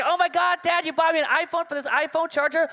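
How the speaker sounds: put-on high, gushing teen squeal; excited daughter. Daughter unwrapping a charger thinks it's an iPhone, high excited gush. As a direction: gush like a thrilled teenager.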